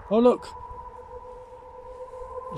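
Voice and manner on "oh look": sudden high exclamation; sharp surprised cry; startled. Real, not acted. Screen suddenly cracks.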